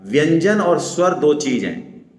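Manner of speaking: ordinary talk, nothing marked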